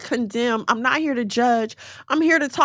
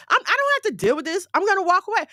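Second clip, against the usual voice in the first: fed up; 8 semitones higher, faster than usual. Acted. Voicing someone refusing to put up more.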